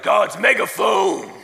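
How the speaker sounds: drawn-out stage shout, loud; slow mock shout. Metal band announcing itself to the arena, slow shout. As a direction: bellow the band name like a metal frontman.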